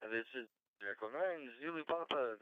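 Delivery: normal talking voice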